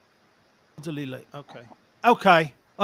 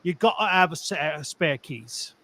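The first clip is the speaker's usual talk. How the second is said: put-on Cockney accent; 2 semitones lower than usual. Giving the story's moral to cohosts, Cockney accent. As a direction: close the tale like a Cockney. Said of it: "and a Cockney accent".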